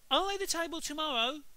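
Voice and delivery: put-on high, loud sweet little boy; prim little brother offering to help; goody-goody Peter voice. A narrator's reading. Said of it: "said Perfect Peter".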